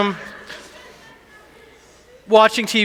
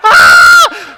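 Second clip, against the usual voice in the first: high, loud yelled scream; the son shrieking while running around; imitated kid scream; 11 semitones higher, 13 dB louder than usual. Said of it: "he's screaming".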